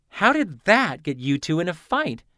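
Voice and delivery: incredulous. Acted.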